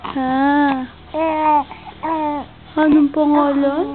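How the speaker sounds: normal talking voice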